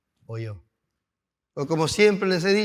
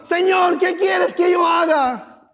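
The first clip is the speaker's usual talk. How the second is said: slow, high, loud shouted surrender; Paul's cry; 12 semitones higher, 6 dB louder, slower than usual. Acted. Paul answering the Lord, shouted plea.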